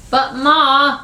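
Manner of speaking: put-on high-pitched childish protest; whining. Boy interrupting his mother's nap order, whining. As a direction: plead with a whine. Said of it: "Kermit whines".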